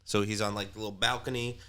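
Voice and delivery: ordinary talk, nothing marked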